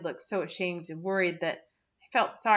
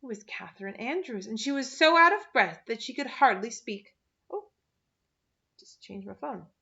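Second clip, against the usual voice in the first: ordinary delivery, nothing marked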